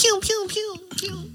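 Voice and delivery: high mock "pew pew" gun sounds; gunshot noises. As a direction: fire off playful gun noises. Mimicking gunshots.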